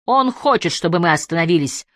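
ordinary delivery

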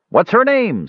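high-pitched shout-out from a crowd; calling out. A narrator's reading. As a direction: call the question up high. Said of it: "someone called out".

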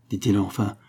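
usual speaking voice

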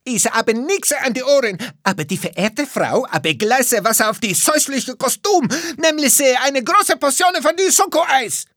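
put-on loud, high-pitched foreign-accented rant; irate ice-cream seller yelling; yelling back. A narrator's reading. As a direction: bellow back indignantly in broken German. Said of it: "Luigi brüllte zurück".

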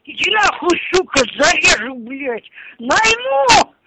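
furious threats; yelling abuse down the phone; angry. Real, not acted.

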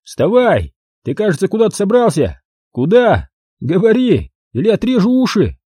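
high, offhand thug threat; casual thug threat. Acted. Thug casually threatens to cut off his ears, high and offhand. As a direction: threaten as if it were nothing. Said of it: "так обыденно и спокойно".

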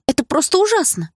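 put-on high, loud girlish voice; twins chiming in. A narrator's reading. Twins' showy condolences. (девчачье поддакивание)

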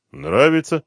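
plain reading voice

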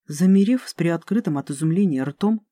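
ordinary delivery, nothing marked

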